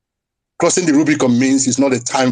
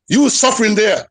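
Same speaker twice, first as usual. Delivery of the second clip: ordinary talk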